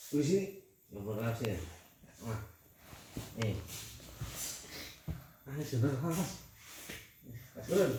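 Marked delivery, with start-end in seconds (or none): none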